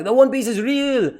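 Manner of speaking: high grand mock proclamation; proclaiming Whitebeard. Imitating Whitebeard revealing the treasure, grandly. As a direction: announce it like a pirate king. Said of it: "He's like".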